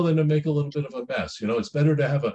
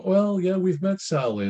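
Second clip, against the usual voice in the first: higher-pitched, tentative agreement; mock hesitant parent; 8 semitones higher than usual. Parent answering a child thinking of marrying Sally, mock hesitant. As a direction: voice a parent cautiously going along, pitched up.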